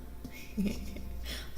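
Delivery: quiet little laugh; soft chuckle. Real, not acted. Streamer amused while chatting to viewers, soft chuckle. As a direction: chuckle softly under the breath.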